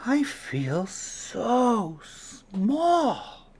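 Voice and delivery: little snail dwarfed by the world; tiny awed snail. A narrator's reading. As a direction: let it come out tiny and amazed. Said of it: "she said to the whale".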